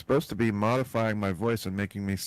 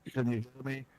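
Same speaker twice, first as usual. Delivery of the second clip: tin can phone sound; 4 semitones higher than usual. Real, not acted. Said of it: "we can hear you about as good as if you were talking into a string and a can's connected".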